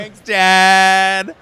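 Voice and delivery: loud, high mock shout to Dad; playful calling out. Actor jokingly thanking his absent father, calling out. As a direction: call it out as if Dad could hear.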